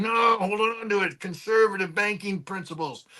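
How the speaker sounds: a stern German banker, hamming it; mock German voice. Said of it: "the Germans are saying".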